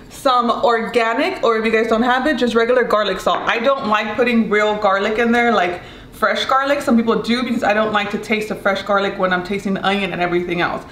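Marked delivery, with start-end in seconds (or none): none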